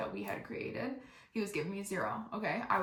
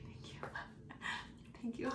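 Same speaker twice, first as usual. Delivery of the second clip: breathy little whispered thank you; mumbled awkward thanks to the staring clerks; 4 semitones higher, 13 dB quieter than usual. Retold or imitated. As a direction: whisper it sheepishly, half laughing. Awkward eye contact, whispered thanks.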